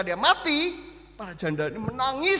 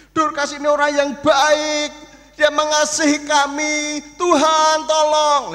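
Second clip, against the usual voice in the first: raised, pleading wail of grief; mourning widow's lament; 8 semitones higher, 8 dB louder than usual. Acted. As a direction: cry out to God over the body. Widows lamenting dead Dorcas.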